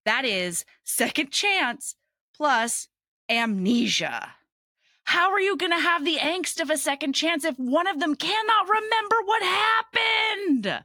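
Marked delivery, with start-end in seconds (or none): 5.0-10.8 incredulous outcry. Real, not acted. Podcaster marveling at a hard trope combination, incredulous outcry. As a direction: climb higher and stretch it out in disbelief.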